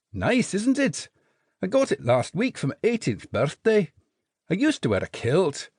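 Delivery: cheerful little Scots private; Scottish jelly baby. A narrator's reading. Jelly baby explains his lineage to Jinx, cheerful Scottish.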